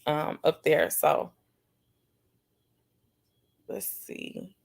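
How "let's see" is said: near-whisper under the breath; muttering to self. Real, not acted. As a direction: whisper it absently while checking. Muttering while reading chat.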